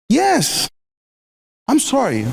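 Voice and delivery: ordinary talk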